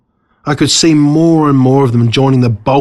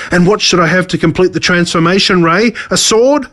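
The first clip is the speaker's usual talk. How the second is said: higher, self-pitying boast; gloating villain; 6 semitones higher than usual. Acted. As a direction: insist on his friends with wounded pride. Villain transforms himself.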